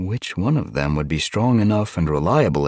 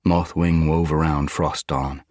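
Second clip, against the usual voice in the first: ordinary delivery, nothing marked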